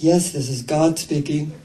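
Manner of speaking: kindly deep-ish voice, barely put on; nice male God voice. God answering the phone, nice male voice. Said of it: "the very nice male voice says".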